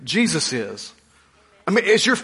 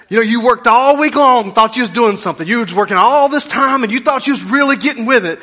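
preaching; 6 semitones higher, 6 dB louder than usual. Acted. Preaching at full force.

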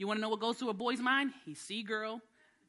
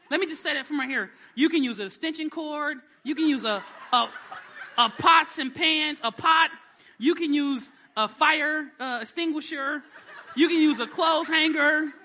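high, loud laughing preaching; 7 semitones higher, 10 dB louder than usual. Real, not acted. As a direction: shout it out, half laughing.

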